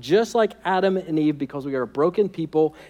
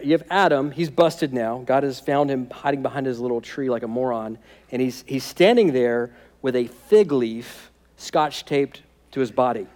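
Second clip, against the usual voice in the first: ordinary talk, nothing marked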